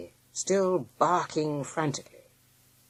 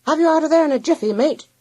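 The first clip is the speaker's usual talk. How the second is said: high, loud, quick call; raised-voice reassurance in a hurry; hearty cry; 13 semitones higher, 9 dB louder, faster than usual. A narrator's reading. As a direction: call out briskly to a mate. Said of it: "cried his friends".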